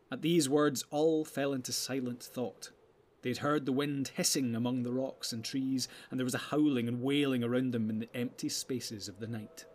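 ordinary delivery, nothing marked

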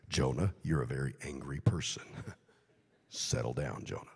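low whispered chiding; God whispering. Acted. God telling angry Jonah to settle, whispered. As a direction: whisper it, half laughing. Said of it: "I can hear God saying".

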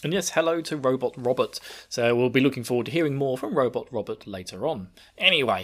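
usual speaking voice

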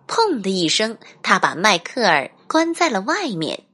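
usual speaking voice

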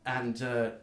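ordinary talk

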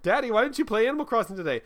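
put-on high, fast kid voice; child voice. Child nagging daddy about Animal Crossing. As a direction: play the nagging little kid.

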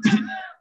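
sharp throat clear. Real, not acted. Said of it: "excuse me, I'm choking".

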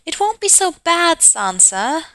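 put-on high-pitched girlish reading voice; high, hopeful storybook voice; acted young girl character. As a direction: chirp it like an eager little sister. Acting out child character from book.

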